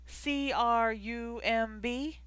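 slow letter-by-letter spelling; each letter apart, unhurried. A narrator's reading. Rose spelling the name.

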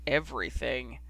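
low, sick-sounding echo of the word; lightly voiced cruel husband; creepy voice. A narrator's reading. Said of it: "in this really sick voice he said".

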